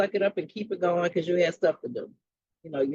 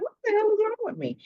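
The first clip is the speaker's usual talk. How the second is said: pitched way up and quick, confused; alarmed disbelief; faster than usual. Retold or imitated. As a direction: blurt it out like you can't believe your own mood. Bewildered self-talk, retold morning.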